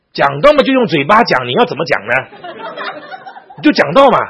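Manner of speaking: loud, high, impatient rebuke; acted-out scolding at full volume; exasperated teacher. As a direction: throw the answer back as if it were obvious. Acting the angry teacher's impatient answer.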